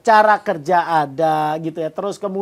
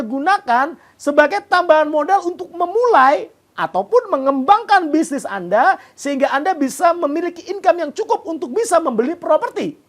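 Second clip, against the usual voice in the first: upbeat TV sales pitch; infomercial; 4 semitones higher than usual. Acted. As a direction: sell it hard to the camera. Sales pitch read to viewers.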